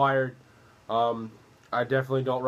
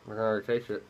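talking around pipe stem; pipe in mouth. Real, not acted. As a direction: lips clenched on pipe. Seen 0: Pipe stem held between lips throughout the labelled words.

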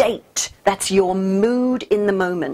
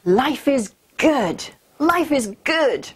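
savoring affirmation; 5 semitones higher, much slower than usual. Shown as a demonstration. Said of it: "Here's another one of my favorites".

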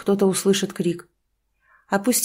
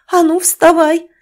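angry shout; 9 semitones higher, 8 dB louder than usual. Acted. Mother rousing drunk son.

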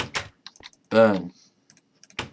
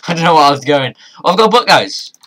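fast, loud and higher, laughing; laughing excitement; 6 semitones higher, 9 dB louder, faster than usual. Real, not acted. Gamer laughing and cheering to viewers about his book. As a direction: burst out laughing with joy.

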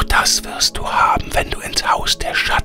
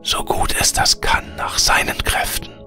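air spirit whispering. A narrator's reading. Air spirit bids Veronika farewell, in a whisper.

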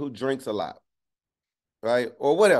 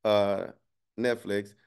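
usual speaking voice

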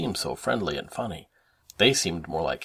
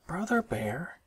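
higher, impatient little-sister call; impatient sister's voice, pitched up; 8 semitones higher, 3 dB quieter than usual. A narrator's reading. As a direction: call to a dawdling brother, fed up. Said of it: "said Sister impatiently".

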